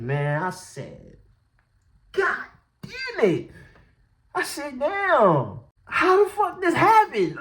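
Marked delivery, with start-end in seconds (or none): 2.1-3.5 high, slow, stretched-out curse; retold dismay. Storyteller replaying the look in the mirror, high mock dismay. 4.8-7.4 high, loud, baffled outburst; retold alarm. Storyteller replaying seeing the bump, high mock alarm.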